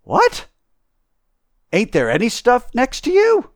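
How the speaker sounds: very high, loud, incredulous questions; tramp's high-pitched amazement; astonished tramp. Acted. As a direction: gape aloud in disbelief.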